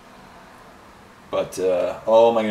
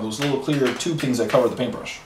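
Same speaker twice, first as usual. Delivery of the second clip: ordinary delivery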